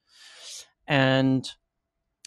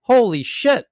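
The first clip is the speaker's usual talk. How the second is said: shocked exclamation; 10 semitones higher, 7 dB louder, slower than usual. Real, not acted. Exclaiming at a broken website.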